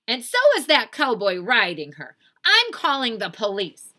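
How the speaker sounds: indignant, high and loud; Eugenia Lincoln. Acted. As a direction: declare it like an outraged bossy sister. Indignant sister threatening police.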